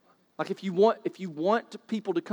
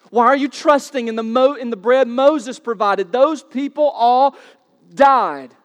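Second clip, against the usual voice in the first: louder and higher, slowing hard on the last words; rising preached climax; 5 semitones higher, 8 dB louder than usual. Acted. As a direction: drive it home until the final "died". Preacher voicing Jesus, building climax.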